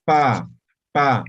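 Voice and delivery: "pa" with lips pressed then released, loud; bilabial pa. Shown as a demonstration. Teacher shows the class "pa" clearly and loud. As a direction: lips together, pop out "pa".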